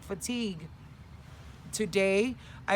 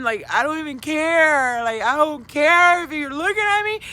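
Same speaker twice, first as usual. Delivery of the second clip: acted public crying; 7 semitones higher, 12 dB louder than usual. Vlogger acting out crying before strangers, mock wail. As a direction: wail defiantly, imitating a public meltdown. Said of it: "I will cry in public".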